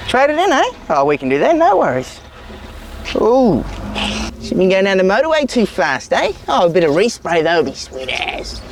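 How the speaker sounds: lightly voiced kiwi car dealer; sly salesman sizing up a trade-in; broad kiwi accent. Dealer inspecting customer's car.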